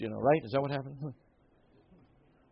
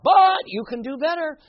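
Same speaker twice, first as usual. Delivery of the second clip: imitating his dad; 12 semitones higher, 8 dB louder than usual. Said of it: "It was like, 'Son".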